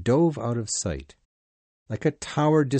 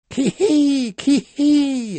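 high, loud whooping yell; yelled war cry; 7 dB louder, much slower than usual. Acted. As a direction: let out a wild high cry. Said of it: "yelled Daggoo".

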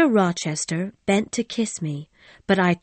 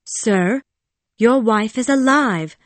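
firm, louder, raised voice; firmly; 3 semitones higher, 5 dB louder than usual. A narrator's reading. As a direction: state it with emphatic resolve. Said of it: "I said firmly".

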